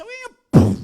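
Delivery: very high, very loud "Uy, puff!" burst; sudden death sound effect. Retold or imitated. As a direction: blurt it like a comic pop of sudden death. She drops dead again.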